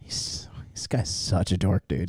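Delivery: hushed whispered aside; whispering. Real, not acted. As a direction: whisper it so they can't hear. Streamer aside over the call.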